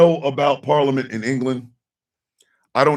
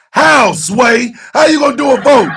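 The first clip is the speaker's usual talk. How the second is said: incredulous shout; 8 semitones higher, 6 dB louder than usual. Real, not acted. Mocking the Democrats' claim.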